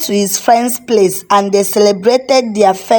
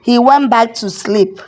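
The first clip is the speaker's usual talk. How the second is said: plain reading voice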